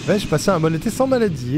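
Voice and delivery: normal talking voice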